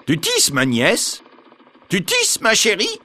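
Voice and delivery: slow, then very high sly witch call. A narrator's reading. Baba Yaga calling from the yard into the house, high witch voice. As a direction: call out sweetly and suspiciously like a witch.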